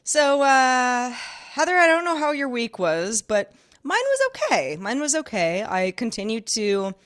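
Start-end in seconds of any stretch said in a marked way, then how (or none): 0.0-4.8 high, bubbly and upbeat; chipper. Real, not acted. Host telling co-host about the week, chipper. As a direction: pipe up extra chipper. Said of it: "You seem chipper".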